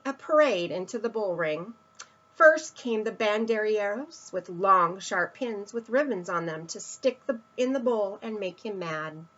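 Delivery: ordinary delivery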